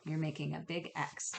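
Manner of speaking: normal talking voice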